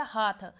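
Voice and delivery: हाथ with an oral vowel only; nasal sound missing. Shown as a demonstration. Teacher repeating a child's error, हाथ without nasalisation. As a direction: keep the nose out of हाथ. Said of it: "लेकिन बालक कह देता".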